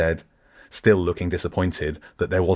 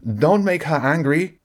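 nervous, higher warning; lacking confidence; 10 semitones higher, 4 dB louder than usual. Acted. Nephew nervously warns his uncle about Jenny, high-pitched. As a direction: warn him off, voice pitched up with worry. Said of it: "with much less confidence than the man".

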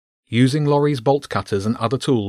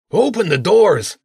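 slow, loud command through the door; shouting; 9 semitones higher, 5 dB louder than usual. A narrator's reading. Zen shouts at the rats to open the doors. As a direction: bellow the order at the locked door. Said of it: "Zen shouted".

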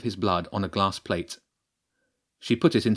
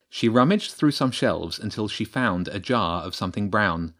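ordinary delivery

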